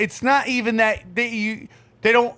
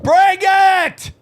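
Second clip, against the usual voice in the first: mock challenge shout; 3 dB louder, much slower than usual. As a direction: holler it like a challenge. Shouted catchphrase in a bit.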